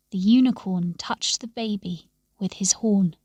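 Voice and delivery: ordinary delivery, nothing marked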